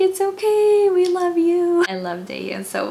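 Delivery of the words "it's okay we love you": high, loud imitation of a cheering crowd; mock fan shout, pitched way up; fans calling out. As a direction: call it up to the stage like adoring fans. Retelling fans shouting comfort to crying singer.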